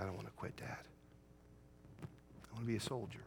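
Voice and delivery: quiet resolve. Retold or imitated. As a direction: say it softly, almost to yourself. Son's quiet resolve quoted.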